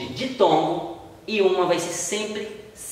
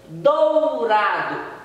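"dourado" drawn out very slowly, the O in "dou" hit strongest; stress on the O; 3 semitones higher, much slower than usual. Shown as a demonstration. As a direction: stretch "dourado" and lean on the O in "dou". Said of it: "Esse O soou mais forte no som do".

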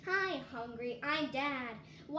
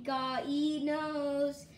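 slow deadpan; much slower than usual. Acted. Slow drawn-out line.

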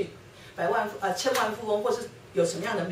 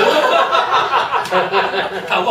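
loud laugh; 11 dB louder than usual. Real, not acted. Speaker laughing at her own language mix-up, loud. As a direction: burst of open laughter.

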